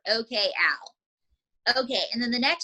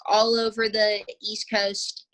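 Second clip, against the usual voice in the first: ordinary talk, nothing marked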